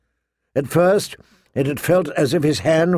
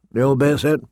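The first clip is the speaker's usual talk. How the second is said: ordinary delivery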